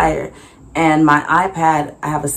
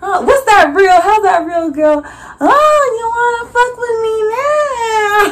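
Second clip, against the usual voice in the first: high, loud, cry-laughing disbelief; fake-offended sass; mock outrage; 3 dB louder than usual. Vendor teasing the man who came back.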